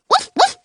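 high, sharp woof; dog bark. Acted. Prince the dog barks at the snow, high yap.

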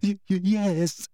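slow, high stammer; gasped. A narrator's reading. Hermione admitting it to Umbridge, gasping stammer. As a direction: gasp it out haltingly. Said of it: "gasped Hermione".

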